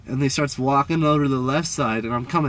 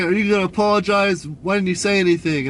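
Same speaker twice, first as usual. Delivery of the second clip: imitated indignant demand, higher pitched; retold yelling; 6 semitones higher, 3 dB louder than usual. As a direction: demand an apology as the angry man. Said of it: "He was just yelling at me".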